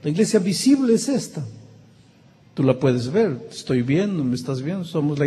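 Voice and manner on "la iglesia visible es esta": emphatic contrast. Acted. Preacher contrasts visible church.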